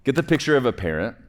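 ordinary delivery

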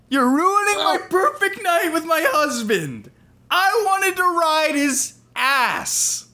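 high, slow, hammed-up rage; furious shriek. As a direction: shriek it in outraged fury. Enraged bride attacks waiter.

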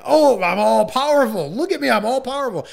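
high, loud mock boasting; gloating spellcaster. Villain boasts to his trapped victim after casting the spell, mock gloating. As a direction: crow about power, hammed up.

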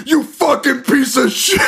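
high, drawn-out furious outburst, half laughing; mock screaming rage. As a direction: explode at it like an overwrought dad. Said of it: "erupt into screaming".

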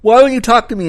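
high, loud exasperated outburst; frustrated girl demanding an answer; mock exasperation at a silent partner; freaking out. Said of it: "Carolyn freaks out and is like".

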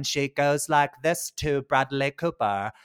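slow, mock British accent; lazy British accent. Shown as a demonstration. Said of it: "lazy ass British accent".

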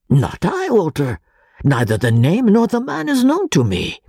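curious Italian professor voice; excitable Italian professor. Acted. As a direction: play the bewildered friend asking why. Pesca voiced by narrator.

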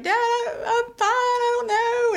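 very high, drawn-out mock wail; crying. Daughter on the phone to her dad, hammed-up crying. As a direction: imitate insisting she's fine while bawling. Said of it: "what to do with crying girls".

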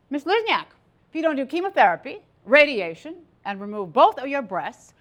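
loud, emphatic doctor's pronouncement; doctor delivering a verdict. Retold or imitated. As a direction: pronounce it forcefully, slowing for the final blow. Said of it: "The doctor said".

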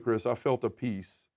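ordinary talk

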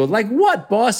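high, curious Italian goon; mob underling. Acted. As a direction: pipe up like a mob lackey. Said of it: "they go".

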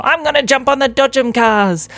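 put-on high, loud kid voice; excited little boy. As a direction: crow it with boyish glee. Samuel excited for ride.

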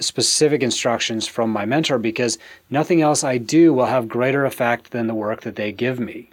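normal talking voice

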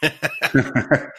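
high-pitched burst of laughter; real laugh, pitched high; amused laugh. Real, not acted. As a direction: crack up at a silly joke. Laughing at the joke names.

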